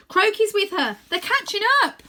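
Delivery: high, loud panicked cry; boy shouting to Rose as the dragon chases; alarmed shout. Acted. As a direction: yell the warning over the wind. Boy warns of pursuit.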